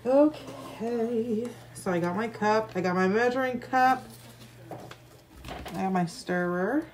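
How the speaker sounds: speaking through a face mask; muffled, talking behind a mask. Real, not acted. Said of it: "I apologize if I sound muffled".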